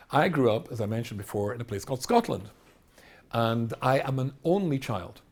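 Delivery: ordinary talk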